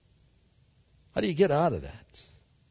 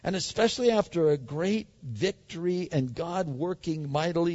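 normal talking voice

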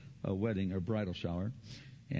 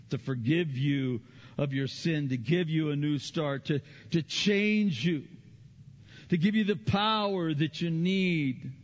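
climbing louder and higher, pressing the point; rising sermon build; 7 semitones higher, 6 dB louder than usual. Real, not acted. Preacher to congregation, building to a forceful plea.

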